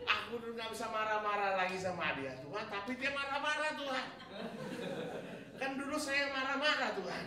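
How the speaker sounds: high, loud laughing protest to God; laughing complaint. Retold or imitated. Retold prayer complaining, laughing.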